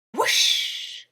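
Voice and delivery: very high, loud, slow spell cry; magic spell shout. A narrator's reading. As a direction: burst out the magic. Spell finally cast.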